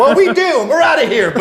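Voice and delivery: high, loud, hurried mock announcement; mock Klansmen bolting. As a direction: play a spooked Klansman bailing out. Voicing fleeing Klansmen.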